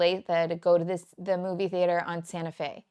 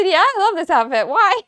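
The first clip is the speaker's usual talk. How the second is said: cheerful reply; 10 semitones higher, 11 dB louder than usual. Retold or imitated. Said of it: "And I said".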